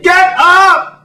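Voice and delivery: high, full-throated command; exasperated high-pitched holler; yelling. Retold or imitated. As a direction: yell at a stubborn mule to move. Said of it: "standing beside her yelling".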